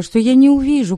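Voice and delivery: ordinary delivery, nothing marked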